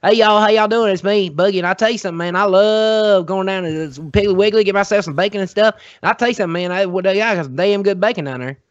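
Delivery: big Southern accent, hammed up. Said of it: "I grew up with a Southern accent".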